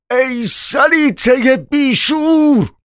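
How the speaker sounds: drawn-out, very high furious scream; enraged cry. A narrator's reading. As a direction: stretch the insult out in a high rage. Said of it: "بالای سر زنش برد و فریاد زد".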